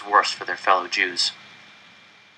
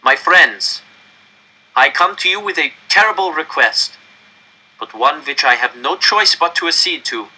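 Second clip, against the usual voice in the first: director's raised, higher announcement; grave official; 9 semitones higher, 3 dB louder than usual. Acted. Director announcing the deportations to the crowd, raised and grave. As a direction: deliver terrible news to a crowd.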